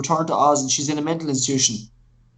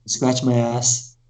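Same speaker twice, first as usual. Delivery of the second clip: slow deadpan worm; 6 semitones lower, much slower than usual. Retold or imitated. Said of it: "your man keeps saying".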